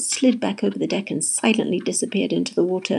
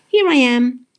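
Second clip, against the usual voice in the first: higher, louder singsong call; cheerful announcement; 5 semitones higher, 7 dB louder than usual. A narrator's reading. Polly popping up from the water, cheerful call. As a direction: sing it out gleefully.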